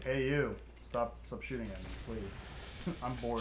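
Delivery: flat, quiet, unbothered plea; bored voice. Retold or imitated. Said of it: "In my normal bored voice".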